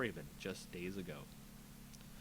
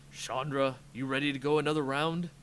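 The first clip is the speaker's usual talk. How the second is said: louder, calling across the fight; 2 semitones higher, 7 dB louder than usual. Acted. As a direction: shout to her over the noise. Gideon calls over battle.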